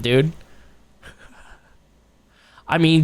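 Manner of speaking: ordinary talk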